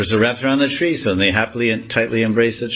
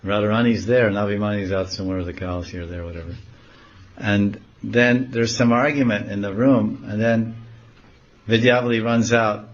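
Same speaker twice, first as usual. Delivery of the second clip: normal talking voice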